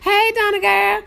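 high-voiced happy hello; excited high-pitched greeting. Real, not acted. Streamer greeting Donna again, excited high hello. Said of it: "my voice get high-pitched".

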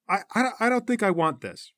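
high, stammering reluctance; hesitant refusal. Acted. Sasha balking at the leader's plan, hesitant refusal. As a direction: back out nervously, stumbling on the start.

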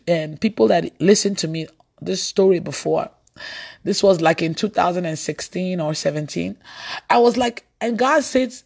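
ordinary delivery

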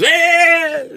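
high, loud whoop; mock table cheer. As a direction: cheer like a whole winning table. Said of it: "gets up and cheers".

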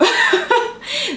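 high playful laugh at the listener; a quick knowing giggle; teasing laugh. Real, not acted. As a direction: laugh as if catching them out. Teasing the viewer.

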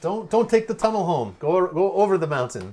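loud, high-pitched shouted advice; urgent warning. Real, not acted. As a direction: call out a warning to a driver. Mock-urgent warnings to driving viewer.

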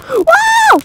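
very high startled yelp; real shocked cry; startled whoa. Real, not acted. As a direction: jump at the near death. Nearly died.

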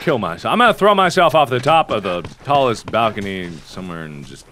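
low, soft gloomy mumbling; mock-morose resignation. Player giving up on the game, mock-morose mumbling.

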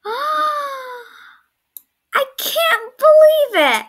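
gasping high disbelief; shocked gasp. Real, not acted. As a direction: shout it in shock. Reveal of hiding spot.

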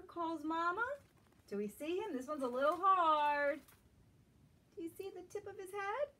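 gentle, lilting storybook read-aloud; reading to children. Acted. Teacher reading a picture book to young children, lilting. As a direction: draw little listeners into the story.

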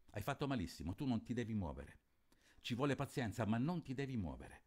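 steady literary reading of a novel; story narration. A narrator's reading. Teacher reads Fenoglio to students, measured narration. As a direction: read it like a book to the class.

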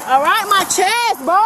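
normal talking voice

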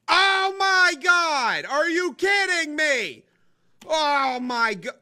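high, drawn-out cry of dismay; loud, stretched-out disbelief; upset outcry. Real, not acted. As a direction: wail it slowly in exasperation. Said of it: "I'm really upset right now".

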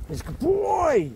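high, slow, sing-song praise for a dog; pet talk. Real, not acted. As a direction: owner cooing praise at his puppy.

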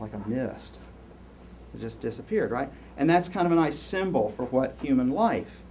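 normal talking voice

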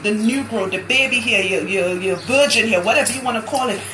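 ordinary talk

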